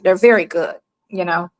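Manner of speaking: normal talking voice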